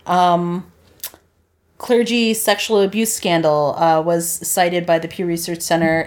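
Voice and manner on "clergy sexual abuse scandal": ordinary talk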